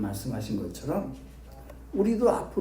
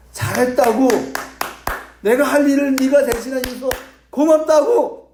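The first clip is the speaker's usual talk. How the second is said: emphatic preaching; 10 semitones higher, 7 dB louder than usual. Acted. What disciples should have said.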